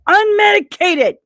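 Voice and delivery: Unmedicated yelled high and loud; shouting Unmedicated for comic effect; mock shout. Shown as a demonstration. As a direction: blurt out Unmedicated in a mock outburst.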